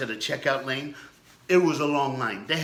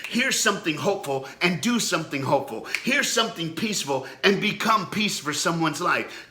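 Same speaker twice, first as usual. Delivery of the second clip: preaching; 4 semitones higher than usual. Acted. Pastor urging viewers to be there in their mind, preaching. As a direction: build it up like a pastor at the pulpit.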